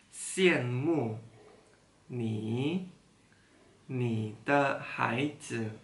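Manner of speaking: word by word, long pauses between; slow, one word at a time; reading aloud haltingly. Shown as a demonstration. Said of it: "Coba baca pelan-pelan".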